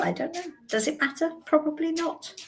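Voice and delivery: normal talking voice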